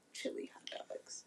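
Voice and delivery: whispered aside; whispering. Real, not acted. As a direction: drop to a whisper for the punchline. Joking aside about vegan food.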